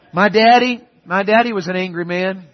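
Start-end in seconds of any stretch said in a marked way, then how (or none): none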